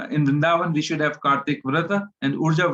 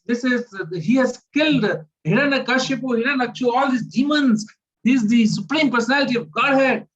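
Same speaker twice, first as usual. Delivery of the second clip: awed praise; 8 semitones higher than usual. Retold or imitated. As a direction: proclaim his glories in amazement. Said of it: "he says".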